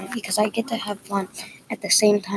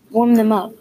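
louder, mildly annoyed coach's order; annoyed, tired tone; 5 dB louder than usual. A narrator's reading. Coach giving the player an order, annoyed and tired. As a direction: sound a touch fed up and weary. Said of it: "He says in a weird tone".

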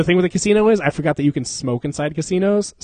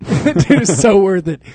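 laughing, louder and higher; laughing agreement; 5 semitones higher, 6 dB louder, slower than usual. Real, not acted. Laughing with his cohost about the burnt mouth. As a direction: burst out laughing as you agree.

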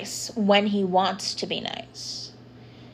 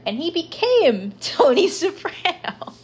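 laughing; 9 semitones higher than usual. Real, not acted. Laughs mid-quote about dad.

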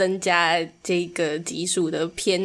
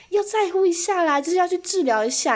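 a much higher, startled voice for the salesman; surprised salesman; 10 semitones higher than usual. Retold or imitated. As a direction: mimic a stunned guy insisting, pitched up. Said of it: "他说".

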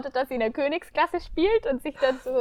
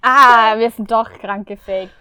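laughing; 12 dB louder, slower than usual. Real, not acted. Laughing at their own fakeness.

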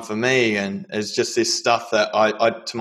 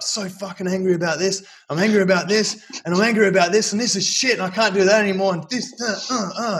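much higher, louder, reliving the rant; angry outburst retold; 9 semitones higher, 4 dB louder than usual. To the psychologist, a higher, louder angry rant. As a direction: let the bottled anger spill out in a higher voice. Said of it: "all this stuff just came out".

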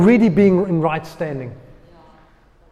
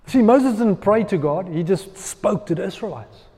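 ordinary talk

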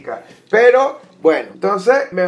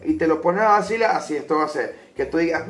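ordinary delivery

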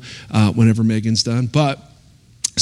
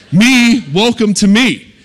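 higher, louder comic self-introduction; mock self-presentation; 8 semitones higher, 4 dB louder, slower than usual. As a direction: announce yourself with playful show. Showing off his looks in a self-quote.

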